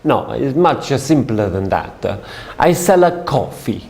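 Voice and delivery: put-on amused, lightly accented denial; Italian businessman. Salesman revealing he sells coffee, light Italian accent. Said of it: "He said".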